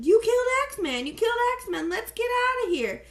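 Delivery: high loud panicked yell. Retold or imitated. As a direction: shout it like you just broke it. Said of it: "So we start yelling".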